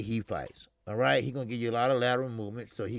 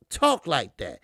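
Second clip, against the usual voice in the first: ordinary talk, nothing marked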